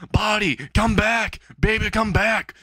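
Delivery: high, drawn-out call after someone; mock calling out. Fallen head calling to its runaway body, mock calling out. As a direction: plead it out high and slow, hammed-up.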